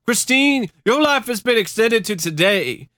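high, indignant heckle; man in the crowd. Acted. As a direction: shout her down in mock outrage. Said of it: "Some guy in the crowd".

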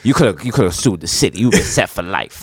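put-on low old-timer advising, slowing down; old head. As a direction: imitate an old dope fiend's low voice. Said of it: "this old head was like".

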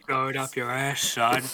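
lightly voiced tough guy; understated put-on brawler; mock wrestler voice. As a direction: echo the threat with a hint of wrestler. Echoing the wrestler impression.

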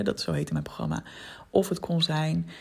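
ordinary talk

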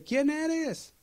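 very high, calling up; shouted question. Retold or imitated. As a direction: yell the question upward. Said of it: "Y le dice".

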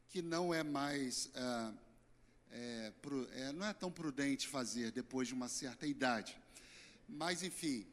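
normal talking voice

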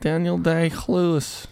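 high, slow Daniel Day-Lewis with a guttural "kh" in Lewis; drawn-out mock pronunciation, throaty start to Lewis. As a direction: stretch it out, scraping a "kh" before Lewis.

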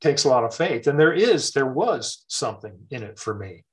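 normal talking voice

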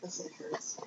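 whispered echo; whispering. Real, not acted. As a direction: whisper it back. Echoing co-host's whispered aside.

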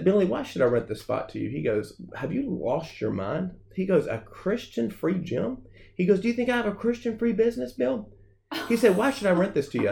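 imitated landlord, high disbelief, questions rising; can't believe you're asking; incredulous landlord. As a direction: scoff in mock disbelief as the landlord.

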